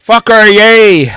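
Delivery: loud, slow cheer. Real, not acted. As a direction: whoop it out. Cheering loudly.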